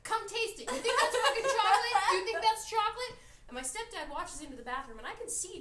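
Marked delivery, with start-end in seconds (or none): 0.0-3.1 high, loud furious challenge; angry yell. Retold or imitated. Larissa shouting at the stepfather, furious yell. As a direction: shout it right at the stepdad. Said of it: "And Larissa's like".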